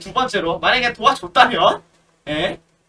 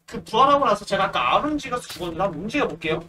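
normal talking voice

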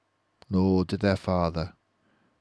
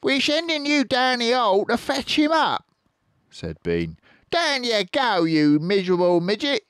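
high and louder bossy order; high farmer voice; 6 dB louder than usual. Acted. Bean ordering Bunce down the hole, squeaky and bossy. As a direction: boss him in a squeaky voice.

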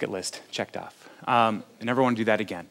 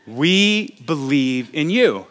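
amused reading; 3 semitones higher, 6 dB louder, much slower than usual. Retold or imitated. Reading the atheist banner.

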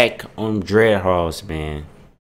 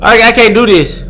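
shaken; 10 semitones higher, 5 dB louder than usual. Real, not acted. Right after the scare.

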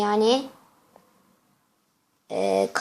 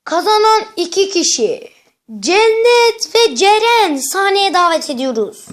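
announcer voice; 7 semitones higher, 4 dB louder than usual. Pretend. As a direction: call the winners up like a host. Host announcing contest winners.

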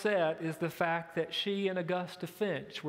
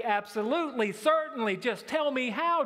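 mock eager answer, much higher and louder; imagined sick man jumping at the offer, higher and louder; eager reply; 7 semitones higher, 5 dB louder than usual. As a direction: gush it like someone desperate to be healed. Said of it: "you would say".

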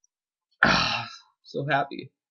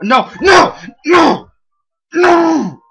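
loud, high yells of no; real frustrated shouting; frustrated shouts; 16 dB louder, much slower than usual. Real, not acted. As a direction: yell no at the screen. Shouting at the game.

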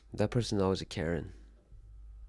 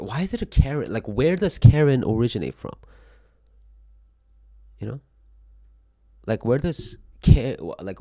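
louder, demanding to know; exasperated; 3 semitones higher, 9 dB louder than usual. Real, not acted. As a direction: press the question, baffled. Exasperated about the name Karen.

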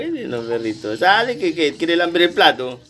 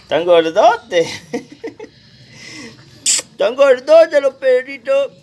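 higher, louder, drawn-out cooing over puppies; gushing pet talk; 11 semitones higher, 8 dB louder, slower than usual. Real, not acted. Owner admiring the chubby little puppies, gushing in pet talk.

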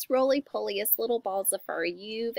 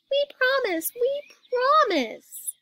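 put-on high-pitched childlike promise; eager cub cry; 12 semitones higher, 4 dB louder, slower than usual. A narrator's reading. Cubs promising Mama, high eager cry. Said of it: "cried the cubs".

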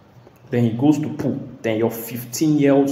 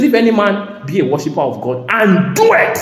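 higher, louder preaching of "If any man be a worshipper"; preaching at full force; 8 semitones higher, 8 dB louder than usual. Real, not acted. Preacher proclaiming scripture loudly to the congregation. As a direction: proclaim it from the pulpit.

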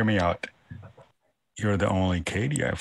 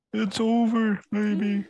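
put-on high, dramatic "it's over, baby"; high mock dramatic; 12 semitones higher than usual. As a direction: say it high like a soap star. Acted quote, pitched up.

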